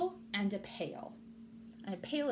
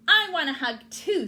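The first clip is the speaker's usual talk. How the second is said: eager; 8 semitones higher, 12 dB louder than usual. Acted. Bird eager.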